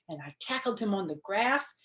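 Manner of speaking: plain reading voice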